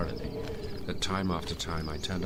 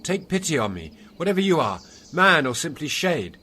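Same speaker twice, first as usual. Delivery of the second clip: loud, high pleading cry for mercy; desperate cry; 12 semitones higher, 11 dB louder than usual. Acted. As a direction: call out in terror to the shade. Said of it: "I cried out to him".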